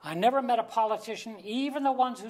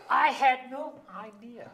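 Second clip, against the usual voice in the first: dismayed realization; 5 semitones higher, 5 dB louder than usual. Acted. Repeated louder, slower.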